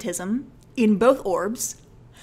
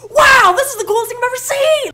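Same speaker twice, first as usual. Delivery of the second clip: much higher and louder, gushing wonder; amazed shout; 12 dB louder than usual. Retold or imitated. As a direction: blurt it out like a kid seeing magic. Amazed by the eye scanner.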